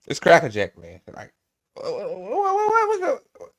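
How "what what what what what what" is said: rising high squeaky whats breaking into a laugh; stunned disbelief. Real, not acted. Streamer reacting to senator, high laughing disbelief. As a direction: sputter in disbelief.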